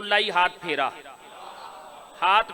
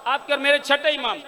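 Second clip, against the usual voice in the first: ordinary talk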